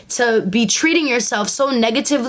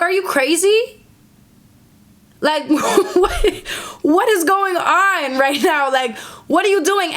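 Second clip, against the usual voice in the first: higher, incredulous man asking what's wrong; baffled boyfriend; 6 semitones higher, slower than usual. Acted. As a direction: play him baffled, half laughing at her. Man baffled by her reaction.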